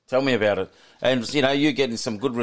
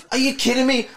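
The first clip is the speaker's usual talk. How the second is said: much higher, amazed question; stunned disbelief; 11 semitones higher than usual. Retold or imitated. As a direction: ask it as if you can't believe your ears. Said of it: "And I'm like".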